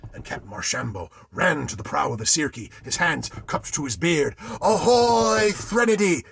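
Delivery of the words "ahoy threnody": hailing a ship at full voice; called out. A narrator's reading. Captain hailing the silent ship, a loud call. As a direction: call out loud across the water. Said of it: "he called".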